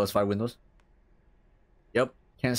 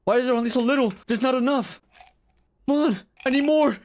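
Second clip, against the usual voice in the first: high, loud whine for more pills; frustrated pleading; 11 semitones higher, 3 dB louder than usual. Real, not acted. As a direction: beg the game for more, voice way up.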